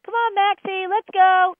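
very high, loud, cheery dog call; happy voice. Shown as a demonstration. Owner coaxes a runaway dog back, happy high voice. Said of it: "you get the happy voice".

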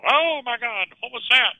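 high, startled cry of the onlookers; imitating shocked bystanders; mock startled diners.

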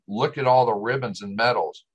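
usual speaking voice

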